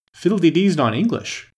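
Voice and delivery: plain reading voice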